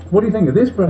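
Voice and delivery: lightly voiced Queen asking about the bread. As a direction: play the Queen, mildly posh-voiced by pitch only. Voicing the Queen.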